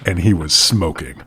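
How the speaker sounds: low, hushed storyteller narration; dramatic story reading. Acted. Podcast host reading a villain's entrance, hushed and dramatic. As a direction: read it like a menacing book scene.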